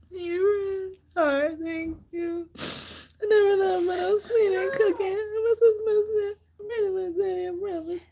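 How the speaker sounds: high, strained crying voice; words squeezed out through real sobs; sobbing. Real, not acted. Thanking viewers while sobbing, high and tearful. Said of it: "I'm crying because".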